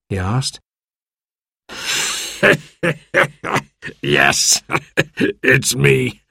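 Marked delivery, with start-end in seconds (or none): 1.7-2.6 wheezy, high giggle; Ben's laugh. A narrator's reading. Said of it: "Ben laughed". 4.0-4.6 low word broken by chuckles; laughing. A narrator's reading. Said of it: "Ben laughed". 4.7-5.4 short wheezy giggle; Ben's chuckle. A narrator's reading. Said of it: "Ben laughed". 5.4-6.2 amused words out of a giggle; laughing. A narrator's reading. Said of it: "Ben laughed".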